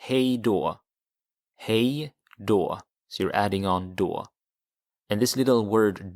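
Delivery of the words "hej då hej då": hej då said as "hay doh"; teacher models goodbye, hej då, twice; Swedish hej då. Shown as a demonstration. Teacher demonstrates hej då to the class. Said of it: "you would say".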